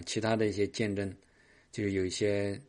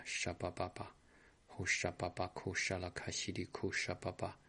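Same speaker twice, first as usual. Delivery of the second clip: speaking in tongues; 9 dB quieter than usual. Acted.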